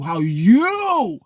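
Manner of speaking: "you" high, loud and drawn out; stress on you. Real, not acted. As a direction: stretch "you" upward at full volume. Emphasises the key word.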